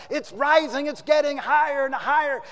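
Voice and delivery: high, loud, urgent cry; acted alarm. Editor warns his puzzled workers, loud and urgent. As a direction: call out as if raising the alarm. Editor shouts from atop desk.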